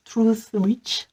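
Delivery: slow, drawn-out English accent; French speaker's deliberate English accent; playful English accent. Shown as a demonstration. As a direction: stretch the English title in a showy English accent. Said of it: "avec mon bel accent".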